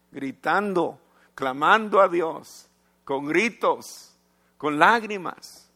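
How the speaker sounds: rising preached cry. Real, not acted. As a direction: build it up like a cry to God. Preacher builds on parents' cries. (clamor predicado, más fuerte y lento)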